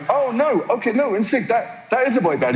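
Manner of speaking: high-pitched sudden realisation. Retold or imitated. Host finally realises the boy band, pitched far up. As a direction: it dawns on you, voice pitched far up. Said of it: "And I went".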